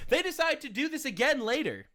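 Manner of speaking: high, mock-cheery sarcasm; sarcastic punchline. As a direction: lift the voice in false reassurance. Sarcastic reassurance turning into punchline.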